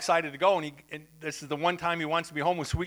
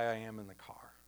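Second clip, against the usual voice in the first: whispered aside; 7 semitones lower, 12 dB quieter than usual. Real, not acted. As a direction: drop to a whisper for "in the car". Punchline dropped to whisper.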